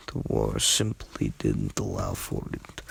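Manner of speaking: usual speaking voice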